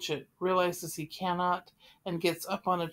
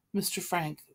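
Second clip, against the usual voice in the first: usual speaking voice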